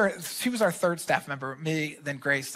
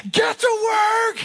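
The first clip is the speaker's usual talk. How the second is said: shouted much higher and louder, slow and punched; coach yell; 6 dB louder, slower than usual. Retold or imitated. Friend imitating the pastor yelling at the team like a coach. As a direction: bark it like a coach at practice. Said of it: "she was like".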